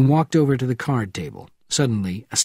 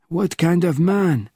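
lightly voiced old man, slightly higher; a hint of a weary old spy at four in the morning; old man roused from sleep, a touch testy; elderly Bernardin, woken; 6 semitones higher, slower than usual. Bernardin questioning.

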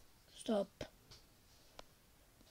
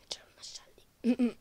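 hushed warning whisper; 7 dB quieter than usual. Real, not acted. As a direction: whisper so enemies won't hear. Sneaking in bush.

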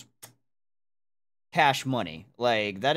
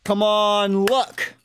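louder, pleading with luck; urging luck; 5 semitones higher, 5 dB louder, slower than usual. Real, not acted. Streamer begging the case roll, louder urging. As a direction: beg the roll to land.

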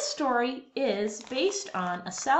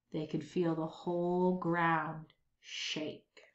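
softer, lower storytelling; hushed suspense; 4 semitones lower, 5 dB quieter than usual. A narrator's reading. Narrator slows as the giant's shadow appears, hushed. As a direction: draw them in with dread.

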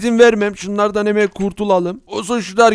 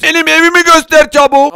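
higher and louder, demanding; impatient shout; 6 semitones higher, 5 dB louder than usual. Acted. Villager yells at the seller from behind, impatient. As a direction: yell it at his back.

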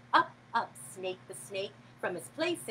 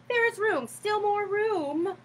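put-on eager boy; 9 semitones higher than usual. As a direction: stretch out more room brightly. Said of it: "said the boy".

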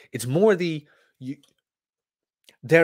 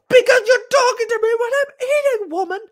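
very high, loud comic yelling; exasperated shout; 7 dB louder, faster than usual. Acted. Man interrupted while eating yells at girlfriend, high furious shout. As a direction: yell it as an over-the-top outburst. Acted outburst at talking girlfriend.

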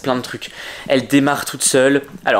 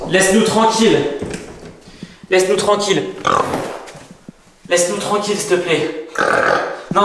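higher, urgent pleading; scared pleading; 5 semitones higher than usual. Acted. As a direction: beg the monster to leave. Begging Piggy to leave.

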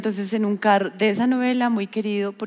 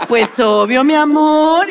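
1 makeup artist's answer; 6 semitones higher, 9 dB louder, slower than usual. Retold or imitated. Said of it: "Y yo".

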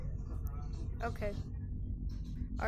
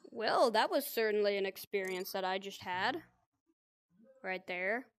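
usual speaking voice

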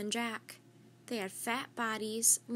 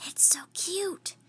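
slow, higher breathy whisper; 6 semitones higher, slower than usual. Acted. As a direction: whisper so the sleeping koala stays asleep. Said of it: "whispered Annie".

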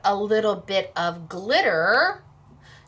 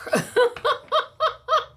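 a bright high burst of laughter; 4 dB louder than usual. Real, not acted. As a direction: laugh at your own joke. Laughs at her own joke.

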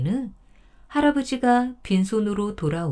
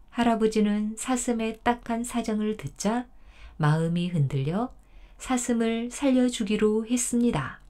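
usual speaking voice

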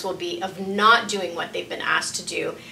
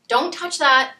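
higher, louder warning with a falling end; stern warning; 5 semitones higher, 4 dB louder than usual. Acted. Parent warning a child off, sharp and stern. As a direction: stop the child's hand with your voice.

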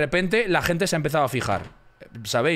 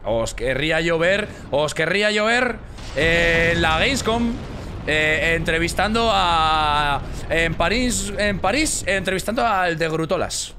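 loud, high challenge to chat; mock outrage at the viewers; heated rant; 4 semitones higher, 4 dB louder than usual. Real, not acted. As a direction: call them out loudly.

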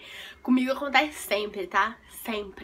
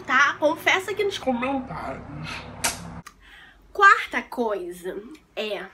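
normal talking voice

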